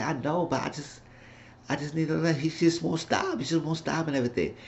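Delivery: lightly voiced bashful girlfriend; timid girlish voice. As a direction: say it small and unsure, like confessing. Esperanza voiced, worn out by Eddie.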